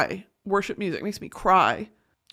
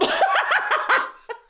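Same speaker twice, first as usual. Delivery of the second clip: high laugh. Real, not acted. Embarrassed after crying on podcast.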